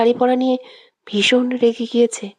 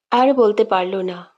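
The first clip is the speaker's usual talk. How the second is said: ordinary delivery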